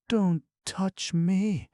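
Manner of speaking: high, slow pleading; imploring falter. A narrator's reading. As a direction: plead haltingly, drawn out. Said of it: "she faltered in an imploring voice".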